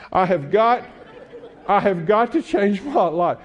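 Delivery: put-on loud, high teenage outburst; exasperated teen. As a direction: whine it loud and fed up.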